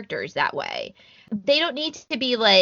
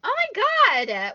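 surprised; 13 semitones higher than usual. Real, not acted. Amazed reaction.

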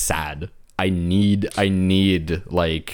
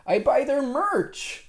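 puppy voice; 3 dB quieter, slower than usual. Real, not acted. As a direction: coo it like talking to a puppy. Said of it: "That's a puppy voice".

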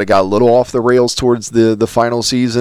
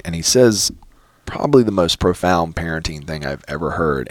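ordinary talk

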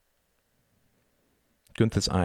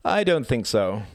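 higher, slow, drawn-out refusal; slow boyish brush-off; dismissive boy; 6 semitones higher, 4 dB louder than usual. A narrator's reading. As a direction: refuse lazily as a haughty boy. Boy refuses, feigning indifference.

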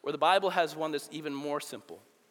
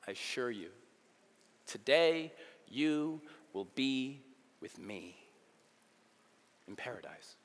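hushed comforting vow; tender promise; 6 semitones lower, 3 dB quieter than usual. Acted. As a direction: promise it gently.

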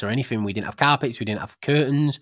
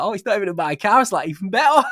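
amused relief; 7 semitones higher, 6 dB louder than usual. Retold or imitated. Delighted it's not his car.

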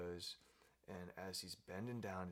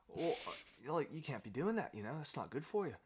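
higher, louder lizard telling off a koala; lightly acted disapproval, pitched up; mock scolding; 6 semitones higher, 6 dB louder than usual.

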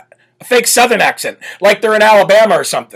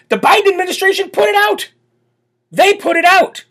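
indignant; 12 semitones higher than usual. Real, not acted. Indignant emphasis.

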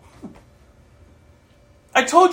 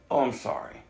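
soft sorry; 12 semitones lower, 11 dB quieter than usual. Retold or imitated. Sincere apology to old friends.

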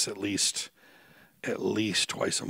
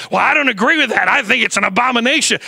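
lightly voiced prim objector, loud; mock objector; 12 semitones higher, 11 dB louder than usual. Preacher mimics a disapproving believer, hammed-up. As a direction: quote the stubborn churchgoer mockingly. Mocking objecting church member.

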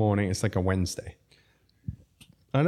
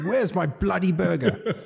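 high, drawn-out posh demand; hammed-up posh impatience; posh British chef; 8 semitones higher than usual. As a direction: imitate a posh, indignant diner. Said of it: "But he's posh. So he's like".

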